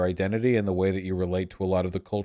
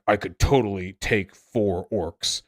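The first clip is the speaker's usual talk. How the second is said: angry Wojak; slower than usual. Retold or imitated. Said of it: "an angry Wojak".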